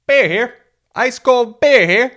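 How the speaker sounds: high, loud beer vendor call; stadium vendor hawking. Retold or imitated. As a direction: holler it like a ballpark vendor. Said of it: "you got these guys".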